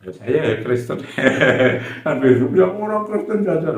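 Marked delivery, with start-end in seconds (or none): 2.6-3.8 high-pitched mock accusation; retold outcry in a raised pitch; imitated angry cry. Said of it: "Macam marah dia".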